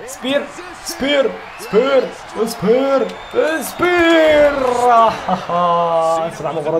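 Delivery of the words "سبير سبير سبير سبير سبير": high, loud, drawn-out yells; hype shout. Real, not acted. Gamer shouting his finisher at the game. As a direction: bellow the move name at the screen.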